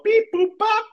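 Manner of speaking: robotic beeps jumping high; beep boop noises. Retold or imitated. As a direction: chirp the beeps like a machine. Smurfs touching his nose.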